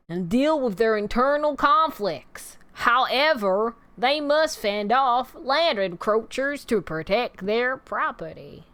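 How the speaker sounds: put-on Southern accent. Reading a Yellowstone overview to viewers, put-on Southern accent. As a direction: read it out in a showy Southern drawl. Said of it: "That's not how I actually talk".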